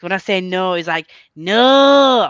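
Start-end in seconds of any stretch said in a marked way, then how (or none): 1.3-2.3 loud, high, overdone Yorkshire "No!"; mock Yorkshire no. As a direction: stretch out "No!" in a mocking Yorkshire twang. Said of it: "I don't sound like that".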